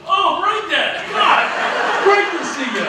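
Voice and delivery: high, loud, excited shout of recognition with a laugh; delighted recognition. Retold or imitated. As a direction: burst out happily, like spotting an old friend. Old coworker recognizes him, delighted.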